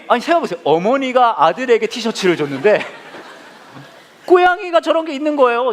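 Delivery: usual speaking voice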